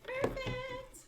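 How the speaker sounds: very high relieved squeak; high squeal. Real, not acted. Crafter when the screw fits, squealing.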